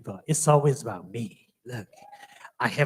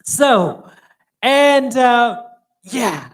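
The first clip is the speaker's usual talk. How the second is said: ordinary talk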